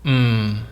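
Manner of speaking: "Mm" hummed long through closed lips; long, closed-lipped "Mm" that eases slightly down; tone with closed mouth. Shown as a demonstration. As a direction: hum "Mm" on one sustained tone. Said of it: "saying the tone without opening our mouth".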